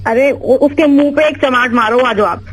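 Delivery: usual speaking voice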